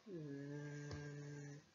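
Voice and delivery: each sound sustained at length on a level pitch; very slow, droning syllable-by-syllable dictation. Shown as a demonstration. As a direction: hold VA, LI and Z as long as the pen moves. Sounds out valise while writing.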